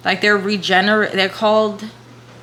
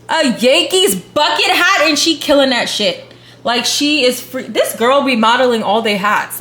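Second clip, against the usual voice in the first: excited; 4 semitones higher, 5 dB louder than usual. Real, not acted. Hyped over hat find.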